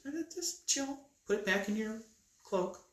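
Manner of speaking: calm him down in a high voice; lightly acted, high-voiced reassurance; mock calming. God calms a panicking Moses.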